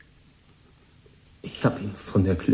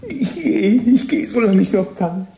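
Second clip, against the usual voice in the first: much higher and louder, frantic; desperate insistence; 7 dB louder, slower than usual. Acted. The boy insists to Tom he is going, frantic. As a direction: cry it out, close to losing control.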